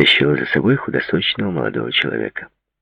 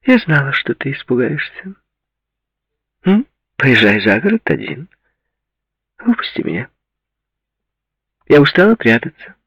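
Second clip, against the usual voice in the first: high-pitched, challenging woman's voice; vexed woman character; 11 semitones higher, 4 dB louder than usual. Acted. Woman reproaching her lover in the car, vexed and high.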